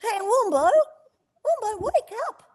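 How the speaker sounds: put-on a cheeky young koala trying to wake his friend; Blinky Bill voice. Voice actor reprising Blinky waking Wombo, high cartoon koala voice. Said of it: "he goes up to Wombo and he goes".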